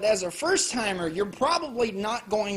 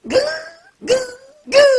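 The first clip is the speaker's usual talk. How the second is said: loud dying "Gah!" in bursts; hammed-up dying yelps; mock death rattle; 11 dB louder, much faster than usual. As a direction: choke out the death noise. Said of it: "I'm gonna be laying there going".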